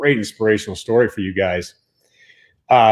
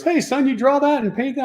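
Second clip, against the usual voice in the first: ballplayer voice; 14 semitones higher than usual. Retold or imitated. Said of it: "He walks up to me and goes".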